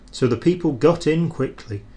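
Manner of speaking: ordinary delivery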